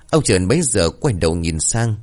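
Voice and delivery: ordinary delivery, nothing marked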